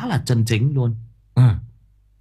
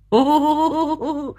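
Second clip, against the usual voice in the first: long high-pitched hoot of laughter. Real, not acted. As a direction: hoot with amusement on a high note. Laughs at own remarks. (cười hú giọng cao)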